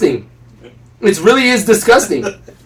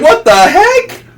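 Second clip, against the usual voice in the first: incredulous; 10 semitones higher, 3 dB louder, much slower than usual. Retold or imitated. Outraged at revealing clothes.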